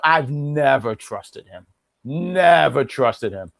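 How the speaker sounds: very slow, every word weighed; slow, deliberate distrust; slow emphatic. Real, not acted. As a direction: drag it out, dead serious. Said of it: "emphatic repeat".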